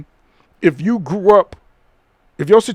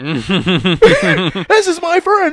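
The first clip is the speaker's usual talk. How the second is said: mock Hollywood schmoozer; 10 semitones higher, 4 dB louder, much slower than usual. Mocking Hollywood friend talk.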